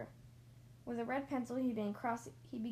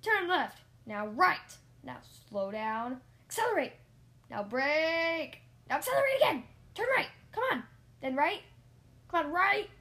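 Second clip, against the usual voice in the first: very loud rapid-fire orders; frantic, bossy instructor shouting; shouted commands; 5 semitones higher, 10 dB louder than usual. A narrator's reading. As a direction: yell directions at a learner driver. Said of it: "Rusty shouted commands at me".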